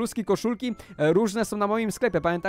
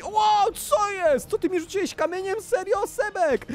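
high, squealed disbelief; astonished, much higher-pitched complaint; indignant shock; 12 semitones higher than usual. Pretend. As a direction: protest in play-acted outrage. Hit by a thrown stone.

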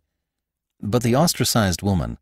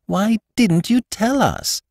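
higher, slow, fond scolding; tender reproach; 14 semitones higher than usual. A narrator's reading. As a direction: chide her lovingly. Wife gently reproaches weeping bride.

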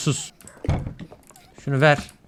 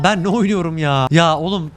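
ordinary talk, nothing marked

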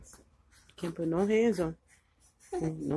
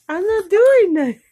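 laughing; 11 semitones higher, 10 dB louder than usual. Real, not acted. Laughing while feeding baby.

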